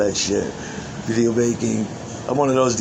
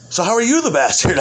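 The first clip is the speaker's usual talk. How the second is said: high, loud mock interrogation; incredulous retold challenge; acted challenge; 8 semitones higher, 5 dB louder than usual. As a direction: throw the question out loud and high.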